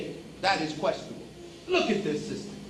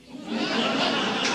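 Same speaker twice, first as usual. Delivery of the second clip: laugh; 6 semitones higher than usual. Real, not acted. Laughs after own joke.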